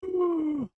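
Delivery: mock Vader mask gasp; imitated Vader gasp. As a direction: suck in air like the helmet wheeze. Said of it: "You hear".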